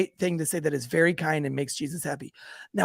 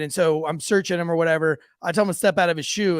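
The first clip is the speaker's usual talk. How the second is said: ordinary talk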